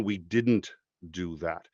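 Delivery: ordinary talk, nothing marked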